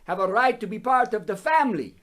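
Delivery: a bad vampire accent on the Pope quote; lightly voiced Dracula, half-hearted; a hint of a Transylvanian count quoting the Pope. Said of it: "He sounded like Dracula".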